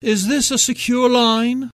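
put-on stiff upper lip British accent, slightly slurred; posh British accent, words a little slurred. A narrator's reading. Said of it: "slightly slurred upper lip British accent".

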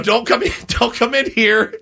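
high-pitched mock panic with a laugh in it; re-enacted frantic plea, pitched high; retold panicked warning. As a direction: urgently keep her out, half amused. Acting his warning to wife.

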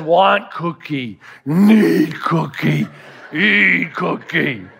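slow, deep-ish monster grunt, word by word; Cookie Monster. Retold or imitated. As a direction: drag each word like a hungry puppet monster. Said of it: "The Cookie Monster says".

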